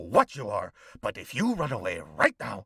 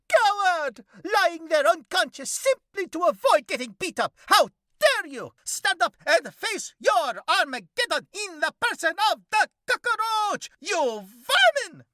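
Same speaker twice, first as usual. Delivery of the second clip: high, loud, mock-heroic vigilante voice; hammed-up cockroach hero; 8 dB louder than usual. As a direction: declaim like a pompous masked avenger. Cockroach berates prone victim.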